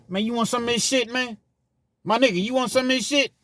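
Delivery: hammed-up prison cellmate, high and loud; inmate voice. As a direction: ham up the cellmate pushing the food. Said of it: "They not gonna be like".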